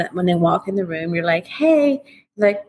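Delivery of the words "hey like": high, airy mock hello; a cold, breath-heavy greeting; breathy greeting. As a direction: imitate a sulky breathy hi. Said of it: "I called it Mister Breathy".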